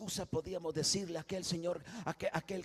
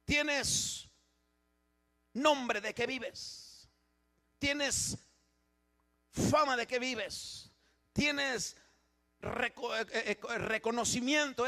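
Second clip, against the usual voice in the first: raised, slow, accusing pulpit cry; preaching rebuke; 7 semitones higher, 7 dB louder than usual. Acted. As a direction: hammer the charge home to the congregation. Preaching God's rebuke to the church.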